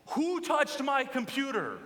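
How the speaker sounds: half-laughing mock roar of a father; booming dad. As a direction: boom it out like an angry father. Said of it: "I hear booming from down the hallway".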